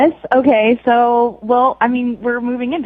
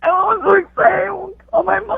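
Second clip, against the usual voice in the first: tearful squealing; 9 semitones higher than usual. Real, not acted. Overwhelmed after the proposal.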